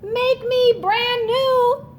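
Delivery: voice from the puddle. Acted. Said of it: "A voice from the puddle said".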